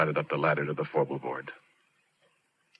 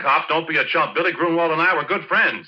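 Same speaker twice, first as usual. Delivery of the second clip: loud, higher, heated denial; angry denial; 11 semitones higher, 6 dB louder than usual. Acted. Porky snapping back at the cop, loud and angry. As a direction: push back hard at the cop.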